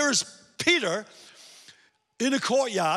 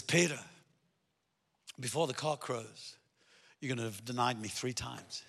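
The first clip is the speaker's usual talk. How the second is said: quiet solemn Jesus; 8 semitones lower, 11 dB quieter than usual. Retold or imitated. Jesus's prophecy.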